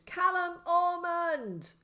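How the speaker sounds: high, loud, drawn-out shout; scream. A narrator's reading. Crazed man screams after the boy in the street. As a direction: scream the full name slowly. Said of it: "he screamed".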